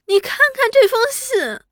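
high-pitched sobbing plea; tearful wail. A narrator's reading. Weeping seventh wife hands over a letter, tearful wail. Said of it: "那七太哭着道".

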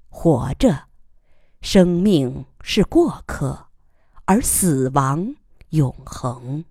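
slow, measured poem reading; poem recitation. Acted. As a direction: read each line slowly and gravely.

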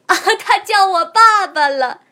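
high, loud joyful exclaim; can't contain the joy; thrilled. Acted. Goose thrilled at being called papa.

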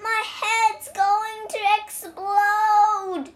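high, loud, slow wail of agony; crying out. A narrator's reading. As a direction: drag it out in head-splitting pain. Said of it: "he cried".

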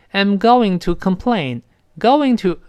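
I'm going to complain read slowly; slow full form. Shown as a demonstration. Teacher showing the slow unreduced form. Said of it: "读得慢一点".

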